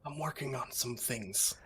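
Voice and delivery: hushed, slow confiding; very soft conspiratorial aside; whisper. Acted. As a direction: lean in and keep it secret. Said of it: "I'll lean and whisper".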